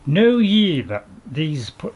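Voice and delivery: slow, loud, grand herald's reading; royal proclamation. Pretend. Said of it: "I'll start again".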